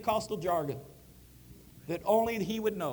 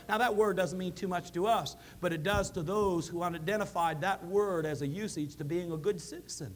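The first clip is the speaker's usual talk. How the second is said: ordinary delivery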